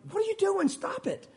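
high-pitched exasperated outburst, then dropping off; mock frustration at a hold-up; exasperated driver. Driver stuck in traffic scolding whoever's blocking, exasperated.

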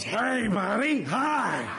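put-on slow, drawn-out drunk greeting; hammed-up sloppy drunk hello; drunk heckler. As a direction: imitate a drunk barging in, stretching each word. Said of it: "usually some drunk that comes up".

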